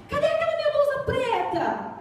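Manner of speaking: high whiny ask; daughter asking. Retold or imitated. Daughter asks for shirt.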